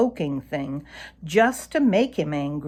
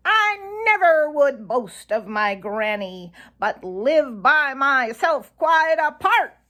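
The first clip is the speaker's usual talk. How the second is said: high mocking jay voice, sung out; Sammy Jay taunting; 8 semitones higher, 4 dB louder than usual. A narrator's reading. Sammy Jay shouts a mean rhyme at Reddy, high and mocking. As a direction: jeer it from the treetop in a high voice. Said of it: "he shouted".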